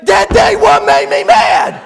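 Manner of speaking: slow, loud furious mock bellow; hammed-up tongue-tied roar; Buddy. Buddy cuts the preacher off, bellowing in worked-up rage. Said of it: "when he gets real worked up".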